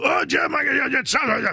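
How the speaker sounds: hoarse, very high, loud yelp; like gargling with razor blades; Tony Romo impression. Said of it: "Is he gargling with razor blades".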